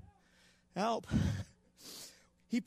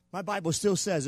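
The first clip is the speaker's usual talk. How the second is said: normal talking voice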